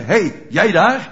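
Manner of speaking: accusing bystander's call; calling someone out. Acted. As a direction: call out sharply to a stranger you recognise. Acted bystander accosts Peter.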